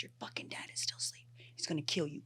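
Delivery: hushed, whispered warning; urgent whisper. Retold or imitated. Mom warning daughter while dad sleeps, urgent whisper. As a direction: whisper so nobody wakes.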